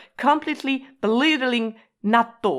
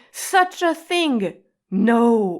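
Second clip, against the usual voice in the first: scornful such a thing; 2 semitones higher, 3 dB louder, slower than usual. Shown as a demonstration. Teacher showing the nante nuance, scoffing. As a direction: scoff at the idea, then shut it down. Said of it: "nante kind of adds a, such a nuance".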